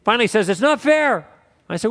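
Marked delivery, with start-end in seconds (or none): 0.5-1.3 frustrated protest. Retold or imitated. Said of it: "he gets all frustrated".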